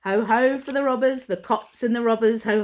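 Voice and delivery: plain reading voice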